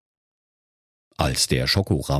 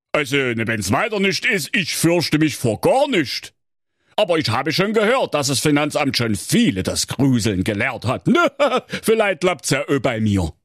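higher, cheeky Saxon lad; Thorsten; 7 semitones higher than usual. A narrator's reading. Thorsten answering King Curry, cheeky and unafraid. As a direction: answer like a carefree young fool.

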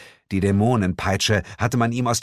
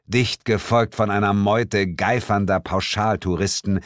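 ordinary delivery

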